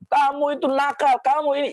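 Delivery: a parent's scolding, shown as a bad example; mock angry scolding. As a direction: snap at the child the wrong way. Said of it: "jangan sampai ... langsung marahin".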